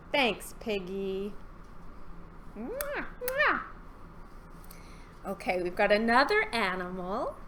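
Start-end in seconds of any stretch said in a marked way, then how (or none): 2.6-3.6 high, soft double mwah; kiss sounds. Pretend. Thanking Piggy goodbye, high mwah kisses. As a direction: plant two noisy little kisses.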